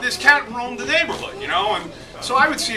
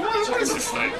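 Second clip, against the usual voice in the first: puzzled; 12 semitones higher, 6 dB quieter than usual. Retold or imitated. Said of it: "And so I'm going".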